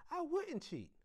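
high-pitched indignant denial; high, insistent little-girl voice; protesting child. A narrator's reading. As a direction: protest your innocence, voice way up high. Girl denies cheating in quarrel.

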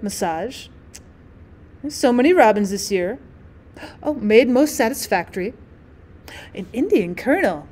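ordinary delivery

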